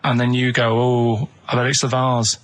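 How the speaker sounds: ordinary talk, nothing marked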